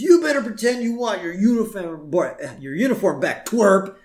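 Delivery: high-pitched cartoon bully; mock bully threat. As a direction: threaten him with hammed-up menace. Jimbo threatening Bart, acted.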